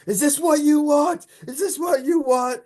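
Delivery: high, slow hammed-up taunt; so dramatic. Taunting the beavers with the severed head, hammed-up and high. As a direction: ham it up like a movie villain. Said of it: "so dramatic".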